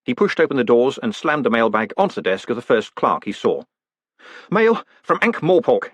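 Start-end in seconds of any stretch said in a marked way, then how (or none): 4.5-5.9 high, forceful growl from the postmaster. A narrator's reading. Postmaster dumps mail on the clerk's desk, growling. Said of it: "he growled".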